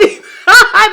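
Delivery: high, loud laughing burst; a real loud laugh; loud burst of laughter. Real, not acted. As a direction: crack up out loud. Laughs at own accent bit.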